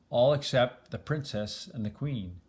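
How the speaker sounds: plain reading voice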